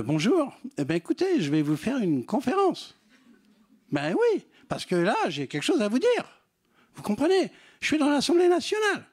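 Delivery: high voice that climbs at every phrase end. Acted. Speaker mocks lecturing at the Assemblée nationale, high rising endings. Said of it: "le troisième élément, quand vous terminez une phrase".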